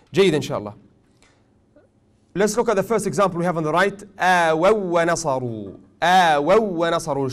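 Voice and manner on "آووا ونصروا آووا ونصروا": second waw held and doubled in آووا; shadda emphasis. Shown as a demonstration. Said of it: "look at the way I've showed this emphasis on the second waw".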